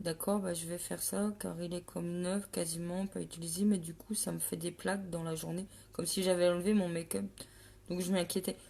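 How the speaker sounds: soft, low, understated reply; subdued follower. Acted. Follower telling the makeup adviser about her concealer, subdued. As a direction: drop right down into a quiet, meek customer's voice.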